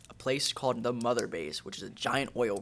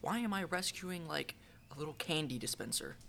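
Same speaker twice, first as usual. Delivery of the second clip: confused gamer; 6 semitones higher, 5 dB quieter than usual. Retold or imitated. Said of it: "and I'm like".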